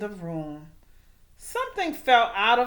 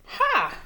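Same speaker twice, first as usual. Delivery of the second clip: a sharp high mean "Ha"; villain's short laugh; 11 semitones higher than usual. A narrator's reading. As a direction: bark out one mean laugh. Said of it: "cranked Tank".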